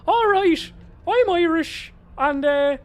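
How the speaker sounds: high, loud hammed-up Irish accent; bad Irish accent. Actress improvising for the casting team, hammed-up bad Irish accent. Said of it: "her attempt at doing an Irish accent".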